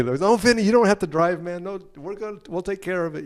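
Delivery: high-pitched mock reassurance, starting loud then easing; placating. Bandmates keeping Vinny from driving, high placating tone. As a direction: talk someone out of it, over-eagerly. Band soothing terrible driver Vinny.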